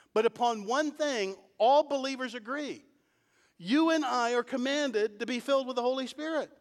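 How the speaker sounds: high-pitched, urgent sermon delivery; impassioned preaching. Real, not acted. Preacher urging believers to be filled with the Spirit, impassioned. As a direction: press the command home with rising fervor.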